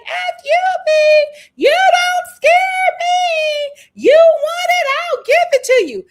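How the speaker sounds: very high, loud hammed-up screaming threats; mock furious shout. Host imitating Kelly being removed, mock furious shout. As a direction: shriek it in imitation of a brawler being dragged off.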